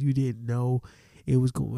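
ordinary talk, nothing marked

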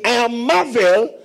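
slow, high, fervent scripture reading; preaching Paul's words. Acted. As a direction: proclaim it slowly like the apostle. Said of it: "Paul is saying".

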